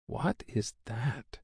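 hushed, slow whisper of awe. A narrator's reading. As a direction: breathe it out slowly. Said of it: "he whispered".

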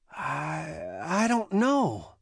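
shaken, halting start rising in pitch; frightened hesitation. A narrator's reading. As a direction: stammer it slowly, still in fear.

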